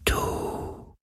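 drawn-out, very soft ghostly whisper; faintest whisper. A narrator's reading. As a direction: whisper like a wind, word by slow word. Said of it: "so leise wie der Schatten des Hauchs eines Flüsterns".

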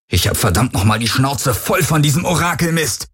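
fed-up bellow at a tormentor; angry roar. A narrator's reading. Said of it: "brüllte er die Helix an".

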